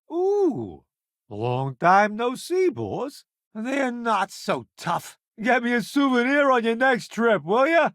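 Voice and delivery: cooing, teasing sing-song. Acted. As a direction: tease the boss sweetly. Playful teasing message to boss.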